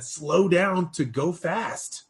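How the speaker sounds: slow, deliberate, rising at the end; calm mentor slowing a fast talker down; slow mentor advice. Retold or imitated. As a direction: let each word land. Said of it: "And so Gene said".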